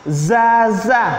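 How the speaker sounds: "Za" with a buzzing z; "Za" with tongue tip on the back teeth, buzzing. Shown as a demonstration. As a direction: buzz the "Za" like an electric shock. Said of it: "kayak kena setrum".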